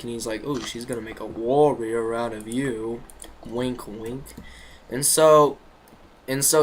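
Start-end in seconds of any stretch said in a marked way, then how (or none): 0.4-4.2 dry mock praise, not meant; sarcastic. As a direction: say it mockingly, with a wink in the voice. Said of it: "like, sarcastic".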